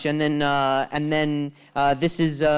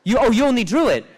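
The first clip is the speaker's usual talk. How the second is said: hammed-up fast outburst, higher and louder; mock outrage; 10 semitones higher, 6 dB louder than usual. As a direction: rant in faked indignation, rising to a shout.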